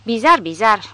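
plain reading voice

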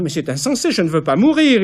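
high, loud terrified cry; panicked. Acted. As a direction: scream that you don't want to die. Old patrician panics before torture.

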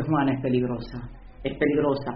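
un toque de demonio grave y apagado, más bajo; voz del infierno. Acted. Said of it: "El infierno dice". (el infierno advierte de Juana, voz grave y baja)

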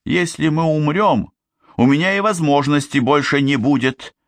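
high, insistent pitch; urgent argument. A narrator's reading. Man forbids girl magic, urgent high argument.

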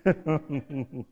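amused rolling laugh; chuckle. Real, not acted. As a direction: laugh at your own joke. Laughs after local joke.

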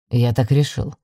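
low, unyielding male voice. A narrator's reading. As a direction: close the matter in a deep voice. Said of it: "непреклонно заявил блондин".

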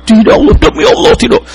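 very high, crying protest; tearful denial. Retold or imitated. Weeping engineer swearing to the preacher, very high crying. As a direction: cry out the oath like a child. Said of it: "Dia nangis seperti anak kecil".

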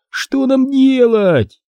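high, long-drawn wail; desperate cry. A narrator's reading. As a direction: stretch it out high in despair. Trapped hero cries out in despair. (протяжный крик отчаяния)

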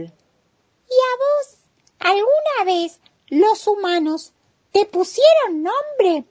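vocecita muy aguda de caracol; caracol curioso. Acted. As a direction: pregunta con voz de caracolito, aguda y lenta. El caracol habla a la tortuga. (caracol curioso, con voz muy aguda)

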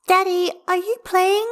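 put-on high, loud child's question; concerned little girl. A narrator's reading. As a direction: ask Daddy in a small girl's piping voice. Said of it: "Hestia suddenly looks concerned".

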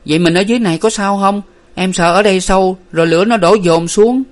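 high, anxious rush of worry; panicky. A narrator's reading. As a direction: fret in alarm about the fire. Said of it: "Hà hốt hoảng thêm".

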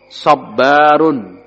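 Sabbarun said very slowly with the doubled b held; slow model word. Shown as a demonstration. Teacher modelling the word for students, slow. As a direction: stretch Sabbarun, lean on the double b. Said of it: "Isim atau kata benda".

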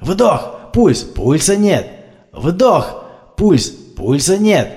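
stuck in the rhythm, calling out; mechanical CPR calls. A narrator's reading. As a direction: rescuers locked into CPR loop.

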